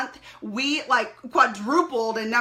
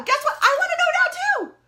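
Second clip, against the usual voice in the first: much higher, louder whiny echo; admitting she's impatient too, in a mock whine; mock childish whine; 3 dB louder than usual. Mock whine echoing members.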